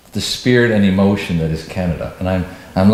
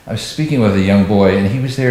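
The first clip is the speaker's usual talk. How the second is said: normal talking voice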